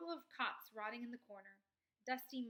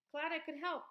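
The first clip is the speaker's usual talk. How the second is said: louder, higher friendly call; 6 semitones higher, 7 dB louder than usual. A narrator's reading. Skunk calls goodbye to leaving travelers, loud friendly call. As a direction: call after departing friends. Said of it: "called the skunk".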